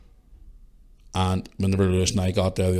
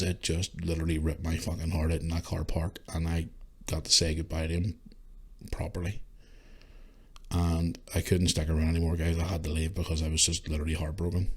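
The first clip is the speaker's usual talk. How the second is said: quiet grief; 4 semitones lower, 9 dB quieter than usual. Real, not acted. Recalling saying goodbye at casket.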